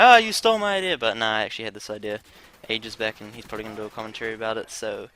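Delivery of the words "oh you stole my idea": high, loud whiny jab; mock-offended friend; mock friend accusing. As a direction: mimic a miffed friend crying foul. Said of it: "he's probably gonna be like".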